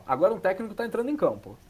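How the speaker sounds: ordinary delivery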